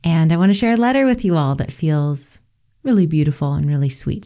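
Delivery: normal talking voice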